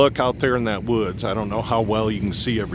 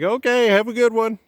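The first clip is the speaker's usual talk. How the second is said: imitating a friendly driver, pitched higher; higher mock voice of a concerned neighbour; passing driver; 13 semitones higher than usual. A driver checking on the stuck truck, friendly and higher. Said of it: "He's like".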